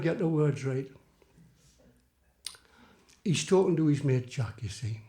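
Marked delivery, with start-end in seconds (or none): none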